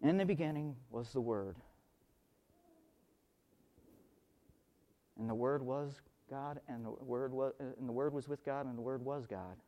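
hushed scripture recital. Shown as a demonstration. Reciting John's opening verse.

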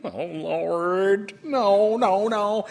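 hammed-up groan of dismay; mock dismay. Retold panic at news.

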